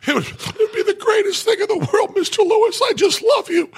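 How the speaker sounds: mock sobbing gush, high and shouted; gushing crying fan. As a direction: blubber like a starstruck kid meeting his hero. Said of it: "And I went".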